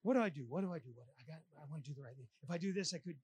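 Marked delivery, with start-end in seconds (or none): 0.5-0.9 the same question dropped softer and lower; softer worried repeat. Retold or imitated. Preacher voicing his own indecision, softly repeated. 0.9-3.2 rapid muttering far softer and lower; fast anxious mumble. Retold or imitated. Preacher acting out second guessing, fast muttered mumble. Anxious inner muddle acted out.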